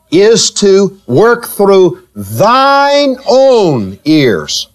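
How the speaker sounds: "thine own" drawn out long and slow; stress on thine. Shown as a demonstration. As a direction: stretch out "thine" and "own" for emphasis. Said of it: "underline the word thine".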